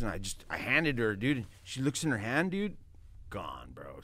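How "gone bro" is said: awed whisper. Real, not acted. Telling friends how she fell apart, hushed whisper. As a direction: drop to a low whisper.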